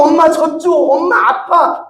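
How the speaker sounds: put-on high, drawn-out childlike plea; crying baby imitation. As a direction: voice a hurting baby begging for its mother. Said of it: "아기가 울 때".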